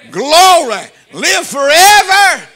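loud, high cry of praise; exultant shout. Real, not acted. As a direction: belt it out like a rejoicing preacher, drawn out. Preacher calling out praise.

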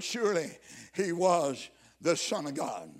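usual speaking voice

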